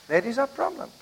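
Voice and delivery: dropped to a near hush on the repeat; quiet repeat. Shown as a demonstration. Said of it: "And that is our problem".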